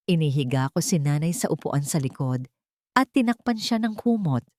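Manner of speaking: ordinary delivery, nothing marked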